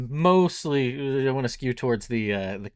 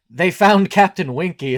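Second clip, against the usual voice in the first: Ace Ventura quote; 7 semitones higher, 11 dB louder than usual. Retold or imitated. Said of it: "one that pops into my".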